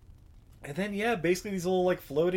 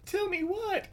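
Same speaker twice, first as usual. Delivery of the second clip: put-on high, light little girl voice; 4 dB quieter, slower than usual. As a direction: squeak it like a small girl. Voicing little girl Molly.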